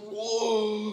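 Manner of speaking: drawn-out amazed gasp of a word; retold awe; amazed whoa. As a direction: act out being floored. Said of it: "I was like".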